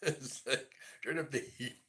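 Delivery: laughing. Real, not acted. Joking about the cold.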